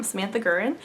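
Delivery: ordinary talk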